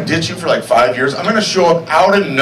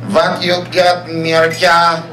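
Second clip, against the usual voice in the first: put-on heavy Yugoslavian accent, plain pitch; thick Yugoslavian accent challenge; slower than usual. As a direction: say it like a Yugoslavian guy taunting. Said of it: "Except it was more like".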